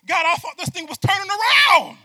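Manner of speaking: high, very loud protesting cry; loud pleading shout at God; anguished shout. Acted. Voicing believer's protest to God.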